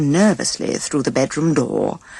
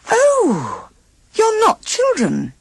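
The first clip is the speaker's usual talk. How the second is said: much higher, louder amazed voice; surprised; 3 dB louder, slower than usual. A narrator's reading. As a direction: gasp it out in high wonder. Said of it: "she said in a surprised voice".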